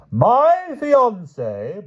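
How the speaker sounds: very slow, high grand proclamation; booming princess. Acted. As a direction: draw out each word. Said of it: "boomed the Queen of Flanders".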